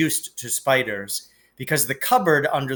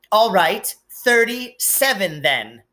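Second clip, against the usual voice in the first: grudging Dudley; 6 semitones higher than usual. A narrator's reading. Dudley grudgingly accepts the recount, sulky higher voice. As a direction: concede grudgingly, tantrum brewing.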